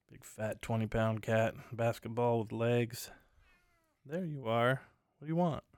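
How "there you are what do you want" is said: higher, fond voice for the cat; talking to the cat. Real, not acted. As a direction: coax the cat with a raised, fond voice.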